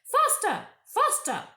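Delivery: very high, loud witch cry; witch driving them on. A narrator's reading. Witch urging Edmund and the dwarf, very high and loud. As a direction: lash them on with the voice. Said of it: "kept on saying".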